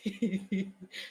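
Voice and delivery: low, soft private chuckle; chuckling to himself. Acted. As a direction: chuckle quietly to yourself. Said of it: "chuckling to himself".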